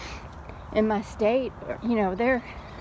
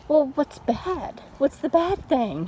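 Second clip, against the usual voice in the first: higher, slow, baffled questioning; puzzled disbelief; 6 semitones higher, slower than usual. Retold or imitated. Mom asking her son what could be bad, higher and slow. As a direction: draw it out like a confused mom.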